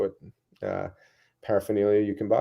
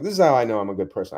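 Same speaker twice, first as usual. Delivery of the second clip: ordinary talk, nothing marked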